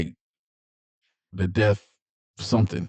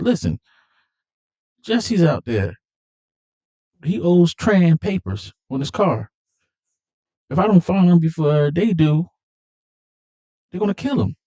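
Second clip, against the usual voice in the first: urgent plea; 8 semitones higher than usual. Retold or imitated. Dom pleads about Jesse.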